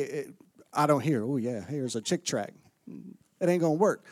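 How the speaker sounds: ordinary delivery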